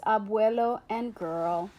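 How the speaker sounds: ordinary delivery, nothing marked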